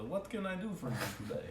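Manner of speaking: the dwarf shopkeeper greets the customer in a fake Draconic accent. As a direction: rattle it off in a faked foreign accent. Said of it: "He'll speak to you in Draconic".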